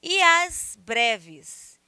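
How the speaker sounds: "E as breves" very slow and loud, "e as" as one glide; verse scanned slowly. Shown as a demonstration. Teacher reading out an example, "E as breves" slowly with "e as" joined. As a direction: draw out "E as breves", fusing "e" into "as". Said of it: "Aí tem mais outro exemplo".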